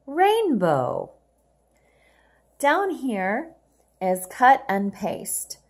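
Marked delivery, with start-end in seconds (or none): 0.1-1.1 rainbow higher and louder; bright confirming read. Shown as a demonstration. Teacher confirming rainbow brightly for the class. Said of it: "Let's read the words together".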